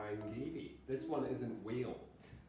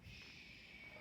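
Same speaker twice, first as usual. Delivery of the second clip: unvoiced hushing shh; gentle breathy shh; soothing shh; 13 dB quieter than usual. Shown as a demonstration. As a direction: shush like calming a crying child.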